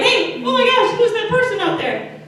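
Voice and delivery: high, laughing mock alarm at a figure on the water; startled disciples. Disciples in the boat spotting a figure, high mock alarm. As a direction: play the spooked disciples with a laugh. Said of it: "they're like".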